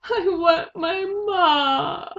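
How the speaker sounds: high, slow tearful wail; slow, high crying plea. A narrator's reading. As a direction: sob it out slowly. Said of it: "cried Mig".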